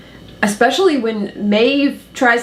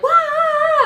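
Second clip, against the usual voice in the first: a high startled wah; excited gasp. Retold or imitated. Hosts reacting to the show, startled shout. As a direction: blurt it out like a jump scare just hit. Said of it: "we're so like".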